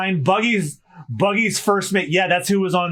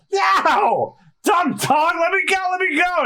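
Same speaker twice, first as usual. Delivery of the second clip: pained yelling; 12 semitones higher, slower than usual. Acted. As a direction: yell to shake off the dog. Dog biting Luffy's face.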